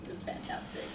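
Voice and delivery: whispered, bone-dry sarcastic aside; dry sarcasm. Real, not acted. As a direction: breathe it out like it was anything but. Ironic comment on being stuck.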